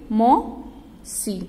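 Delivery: "मौसी" drawn out very slowly; slow sounded-out word. Shown as a demonstration. Teacher reading "मौसी" in a rhyme to children, slowly stretched. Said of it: "Gauri की".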